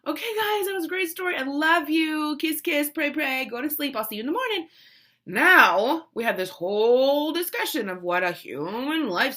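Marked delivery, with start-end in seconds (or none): 0.1-4.7 higher, singsong mommy voice; light, upbeat tucking-in patter; breezy bedtime goodnight. Retold or imitated. Mother saying goodnight to kids, imitated singsong. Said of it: "What should have been like".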